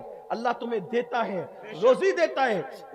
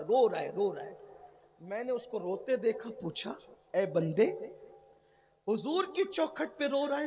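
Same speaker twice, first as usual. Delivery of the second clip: hushed, lower, tender story voice; gentle grieving narration; 5 semitones lower than usual. Acted. Soft retold story of weeping man.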